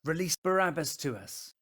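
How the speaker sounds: high, forceful shout; crying out. Acted. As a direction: cry out as one angry mob. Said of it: "With one voice they cried out".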